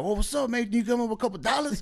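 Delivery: high, quick lookalike hustler. Retold or imitated. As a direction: imitate a rapper soundalike hitting someone up, pitched high. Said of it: "And perfect impression".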